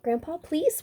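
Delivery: high pleading "please" with a lift; begging. A narrator's reading. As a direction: ask sweetly, voice high and rising. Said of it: "begged the biggest baby".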